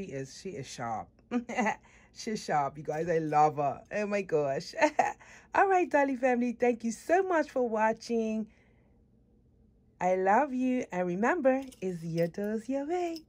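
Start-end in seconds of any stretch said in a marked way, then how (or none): 5.5-13.2 loud, bright outro to viewers; upbeat farewell to the Dolly family; vlog sign-off. Acted. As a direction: wrap up cheerfully for the audience.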